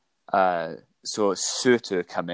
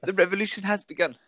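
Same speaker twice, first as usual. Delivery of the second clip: put-on New Zealand accent; 10 semitones higher, faster than usual. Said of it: "New Zealand accent".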